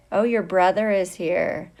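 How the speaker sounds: normal talking voice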